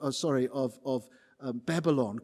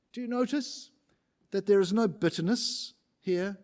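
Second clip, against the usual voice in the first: ordinary talk, nothing marked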